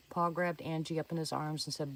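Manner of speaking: ordinary delivery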